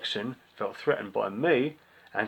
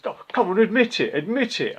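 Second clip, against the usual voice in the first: lightly voiced, higher, louder nagging demand; retelling the weird kid's insistence, higher and pushier; mock pushy kid; 9 semitones higher, 4 dB louder than usual. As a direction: imitate a pest pressing for a confession.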